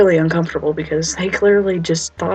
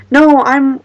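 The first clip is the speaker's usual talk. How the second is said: flustered protest; 5 semitones higher, 9 dB louder than usual. Retold or imitated. Protesting to the cop.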